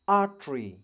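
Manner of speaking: artery said cleanly, r not pronounced; careful model take of artery; right artery. Shown as a demonstration. Teacher models the correct artery for students. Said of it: "इसका right pronunciation है".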